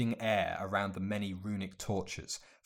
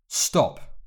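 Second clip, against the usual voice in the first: sharp command; 5 semitones higher, 7 dB louder than usual. A narrator's reading. Harry halting the elf.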